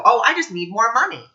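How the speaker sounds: mock whiny wanting ego. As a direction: parody the grasping ego, a touch hammed-up. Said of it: "we're thinking with the ego".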